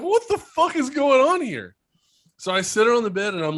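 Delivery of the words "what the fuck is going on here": high, baffled disbelief. Retold or imitated. Dad to his sleepwalking daughter, high baffled disbelief. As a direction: throw it up high, completely lost. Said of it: "I'm like".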